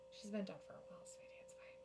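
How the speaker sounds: whispered, very soft. Real, not acted. Gently telling her friend [name] is dead, whispered. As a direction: break the news gently in a whisper.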